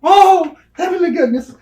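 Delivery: high, loud, put-on prissy gasp; hammed-up shocked exclamation; mock prim exclaim. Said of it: "I just say".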